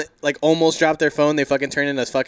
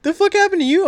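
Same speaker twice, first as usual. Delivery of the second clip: much higher and louder, incredulous; shocked buddy; 12 dB louder than usual. Retold or imitated. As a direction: blurt it out in disbelief. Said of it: "He's like".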